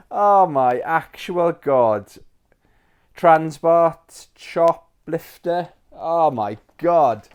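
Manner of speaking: drawn-out, high and loud amazement; savouring each remembered name; slow nostalgic awe. Real, not acted. Reading old wishlist games aloud, awed.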